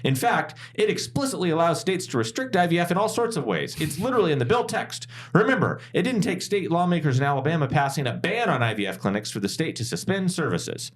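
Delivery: high, angry indignant senator; indignant Karen senator voice. Retold or imitated. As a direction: play an outraged Karen senator at the podium. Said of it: "my best, uh, angry, indignant Karen senator voice".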